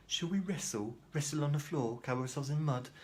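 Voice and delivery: high-pitched, incredulous mock offers; mock suggestions. Patient answering psychiatrist's open question, mockingly incredulous.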